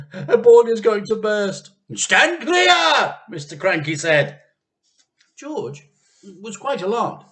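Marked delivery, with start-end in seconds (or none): 0.1-1.7 high, loud panicky wife; frantic wife fearing the worst; panicked cry. Acted. Mrs Cranky panicking. 1.9-3.2 high, loud call to clear off; urgent husband shouting a warning. Acted.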